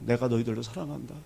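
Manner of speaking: soft, low 내가 너희들을 사랑한다; the real meaning, spoken soft and tender. Retold or imitated. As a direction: say it gently and softly. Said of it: "죽으라 이런 말이 아니에요".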